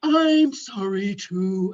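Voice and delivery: slow, drawn-out lowing; imitated mournful cow-like drone; mother-cow voice. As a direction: moo the apology, stretching each vowel. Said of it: "like a mother cow".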